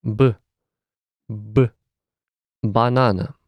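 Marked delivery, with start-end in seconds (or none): none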